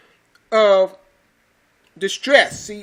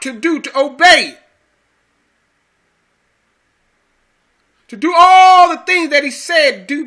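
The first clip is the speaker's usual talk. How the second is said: loud, raised-pitch sermon delivery; forceful preaching; 5 semitones higher, 6 dB louder than usual. Real, not acted. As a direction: drive each phrase home to the congregation.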